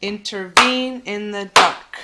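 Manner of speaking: "Intervene in the dark" slow, loud on VENE and DARK; light run-up, then punch VENE in "Intervene" and "dark"; anapestic rhythm. Shown as a demonstration. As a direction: beat out in-ter-VENE in the DARK. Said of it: "getting kind of the musicality".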